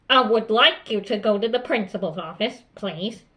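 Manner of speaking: controlled, polite but firm order; even-paced teacher request, no yelling. Shown as a demonstration. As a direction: send them out calmly and firmly. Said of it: "in a firm tone of voice".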